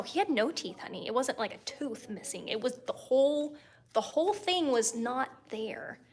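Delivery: ordinary talk, nothing marked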